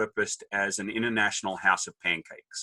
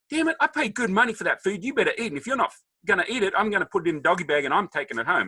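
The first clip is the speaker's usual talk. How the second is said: high, fast, hammed-up outrage; mock indignant rant; 9 semitones higher, 4 dB louder, faster than usual. Thinking to himself at dinner, mock indignant and high. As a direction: grumble like a stingy date. Acted inner rant about food.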